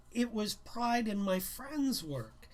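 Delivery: usual speaking voice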